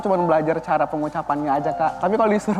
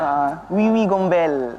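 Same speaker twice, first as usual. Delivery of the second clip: slow French-accented ghost name; slower than usual. Shown as a demonstration. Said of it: "kalau bahasa Perancisnya".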